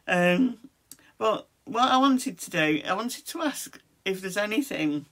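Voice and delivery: usual speaking voice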